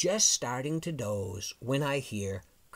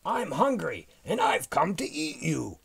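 loud, raised storybook monster voice; roaring dinosaur; 5 semitones higher, 5 dB louder than usual. A narrator's reading. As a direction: roar it like a hungry dinosaur. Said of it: "roared the super hungry dinosaur".

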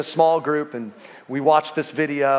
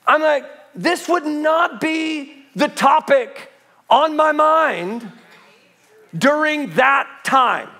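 raised, insistent pulpit delivery; impassioned preaching; 8 semitones higher than usual. Real, not acted. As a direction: preach it hard, driving each phrase home.

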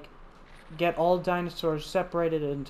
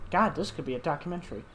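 normal talking voice

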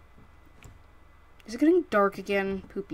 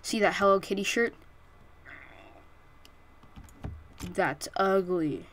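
mock roasting teacher. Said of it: "They're like".

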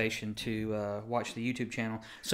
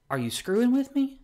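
high, louder retold disbelief; mock incredulity; 13 semitones higher, 5 dB louder than usual. Retelling his reply to viewers, incredulous squeal. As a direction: voice jumps up in disbelief.

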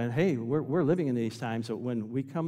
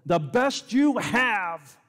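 high, loud and drawn out; full-force preaching; 8 semitones higher, 8 dB louder, much slower than usual. Real, not acted. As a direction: stretch each word into a pulpit cry.

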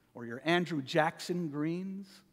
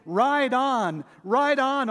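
higher and louder, triumphant; joyful loud; 6 semitones higher, 6 dB louder, slower than usual. Shown as a demonstration. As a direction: ring it out in triumph. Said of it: "We sing".

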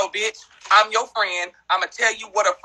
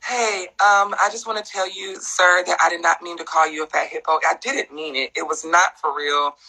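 hammed-up sorry statement from a celebrity; fake celebrity apology; 6 semitones lower than usual. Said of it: "act like every other celebrity and issue an apology".

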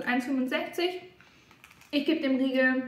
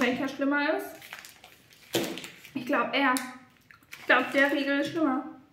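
usual speaking voice

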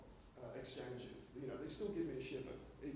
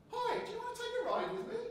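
put-on much higher, louder chirpy kids' ride voice; squeaky cartoon pig voice; 7 dB louder than usual. Speaker imitates Peppa Pig ride greeting him, high and chirpy. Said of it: "I heard this voice go".

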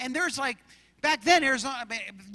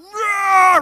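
loud high mock roar; hammed-up monster roar to scare the scarer; roaring back; 8 semitones higher, 11 dB louder than usual. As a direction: roar right back at him. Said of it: "I went".

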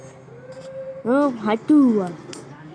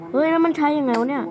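normal talking voice

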